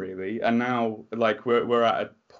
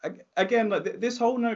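proud tears; 13 semitones higher than usual. Real, not acted. Moved after friend's praise.

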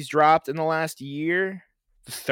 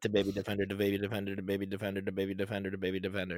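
low, quiet monotone repetition; five times fast; 4 semitones lower, 10 dB quieter, much faster than usual. Shown as a demonstration. Co-host answering a dare to repeat it five times fast, quick low monotone. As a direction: rattle it off under the voice, evenly. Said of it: "Say that five times".

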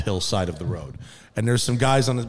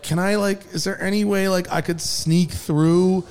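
pleading; 7 semitones higher than usual. Retold or imitated. Pleading with road crew.

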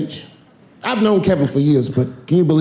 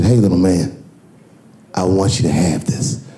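Kevin voice; 9 semitones lower than usual. Retold or imitated. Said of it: "He said".

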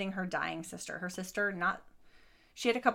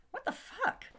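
squeaky high burst of disbelief; a real gasp of shock, pitched way up; 5 dB quieter than usual. Real, not acted. Co-host reacts to the husband's silence with real shock.

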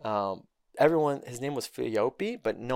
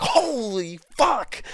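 a slow, high, stretched "holy fuck"; drawn-out disbelief; 10 semitones higher, 5 dB louder, much slower than usual. Retold or imitated. The crash hits and he gasps out a stretched holy fuck.

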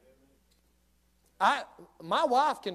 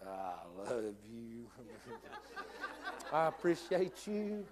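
sweet fake politeness; 7 semitones lower, 9 dB quieter, slower than usual. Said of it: "And you go".

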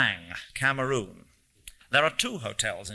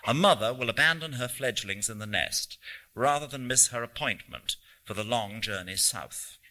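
plain reading voice

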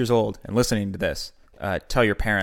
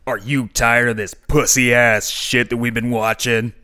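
put-on slow, swaggering kids' TV host; cool kids' show host; 4 dB louder, slower than usual. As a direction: play a crude host talking to kids. Host mocks kids' show opener.

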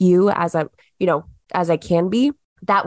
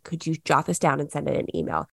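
a quieter, patient second ask; same request, said softer and calmer; softer, patient repeat; 4 semitones lower, 7 dB quieter than usual. Shown as a demonstration. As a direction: drop the volume and ask it again gently. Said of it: "Replying consistently".

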